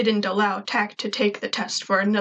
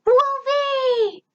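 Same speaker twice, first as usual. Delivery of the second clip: high, bright squeal of a name; little robot shouting with glee; excited shout; 3 dB louder than usual. A narrator's reading. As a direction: shout it with joy. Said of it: "he shouted".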